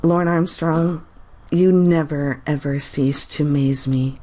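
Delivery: ordinary talk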